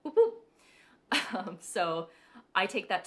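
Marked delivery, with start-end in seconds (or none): 0.0-0.3 high, loud siren whoop; mock whoop. Echo effect noticed, loud playful siren whoop. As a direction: yelp it like an alarm.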